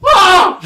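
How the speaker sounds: high piercing shout; loud shriek. Shown as a demonstration. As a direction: let out a sudden yell. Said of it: "Sound more like this".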